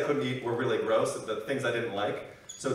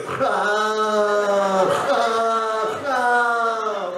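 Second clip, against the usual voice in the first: loud, high mock snore with British vowels; hammed-up British snoring cries; 7 semitones higher, 5 dB louder, much slower than usual. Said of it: "he's snoring".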